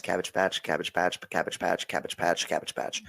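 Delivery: rattled off in an even, repeated run; rapid tongue-twister. Shown as a demonstration. Podcaster testing if they can say it five times fast, rapid run. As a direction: reel it off five times without a break. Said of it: "like, five times fast".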